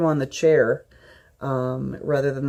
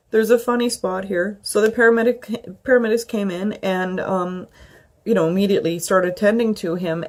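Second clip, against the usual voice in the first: normal talking voice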